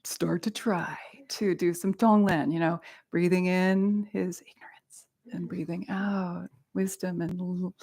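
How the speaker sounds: soft breathy practice whisper. Shown as a demonstration. Teacher whispering the Tonglen breathing. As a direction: whisper it like a meditation.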